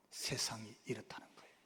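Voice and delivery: hushed, breathy aside; whisper. Real, not acted. Preacher hushing to tell the congregation what the world is. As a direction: drop to a whisper for weight.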